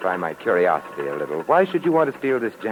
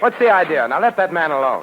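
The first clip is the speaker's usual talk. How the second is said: louder, higher, confronting; angry challenge; 7 semitones higher, 6 dB louder than usual. Acted. Saint confronting thugs attacking the old man, loud. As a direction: demand an answer from the thugs.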